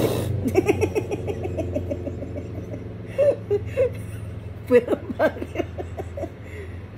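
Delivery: long real laugh, sliding up high; breathless drawn-out laughing. Real, not acted. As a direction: laugh helplessly while the pet fights. Owner laughing at struggling pet.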